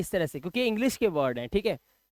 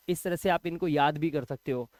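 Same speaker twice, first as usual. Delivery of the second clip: ordinary talk, nothing marked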